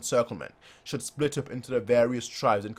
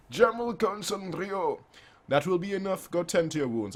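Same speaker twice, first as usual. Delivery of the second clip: lofty general; 7 semitones higher than usual. Acted. Voicing a commanding general.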